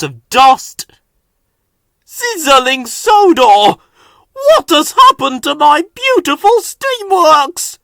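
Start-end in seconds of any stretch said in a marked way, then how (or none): 2.0-7.8 high, loud dismay; dismayed shout. A narrator's reading. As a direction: cry out at the mess. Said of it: "said the voice".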